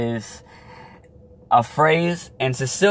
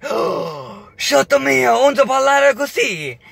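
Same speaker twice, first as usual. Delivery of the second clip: imitating grandma's dismay in Italian; shocked Italian grandma; 13 semitones higher, slower than usual. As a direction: cry out in horror like a scandalized grandmother. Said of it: "right away she's like".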